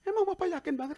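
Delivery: put-on high-pitched kid's question; imitated child. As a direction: ask like a doubting little child. Child questions father in story.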